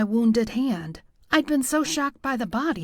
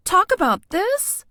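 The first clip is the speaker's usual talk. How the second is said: sudden high, louder disbelief; voice shoots up; 9 semitones higher, 5 dB louder than usual. Acted. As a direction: squeak it out an octave higher in shock. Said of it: "I heard my voice go up an octave".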